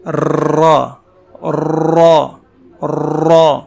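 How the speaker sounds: را full-mouthed with a trilled ر; rolled heavy ra. Shown as a demonstration. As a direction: roll the ر of را and fill the mouth. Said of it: "اتنا تکلف سے میں پڑھ رہا ہوں". (منہ بھر کے لرزتی را)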